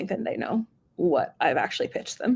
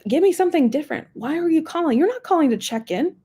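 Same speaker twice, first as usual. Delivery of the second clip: exasperated; 8 semitones higher than usual. Retold or imitated. Her exasperated reply to reps.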